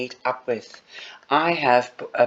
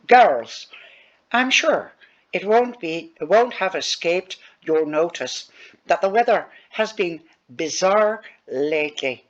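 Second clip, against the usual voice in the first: teacher's announcement in an Irish accent, "sure" lifted higher; 5 semitones higher than usual. Shown as a demonstration. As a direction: do the line again with a light Irish lilt. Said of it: "She might even talk in an Irish voice like this".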